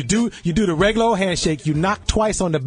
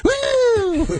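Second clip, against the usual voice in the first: a sudden high squeal of woo; cartoon squeal of woo; 4 dB louder than usual. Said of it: "they gonna be like".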